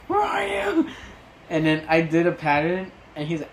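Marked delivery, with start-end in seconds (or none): 0.1-0.9 confused shout. Retold or imitated. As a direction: shout it lost and searching. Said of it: "he was like".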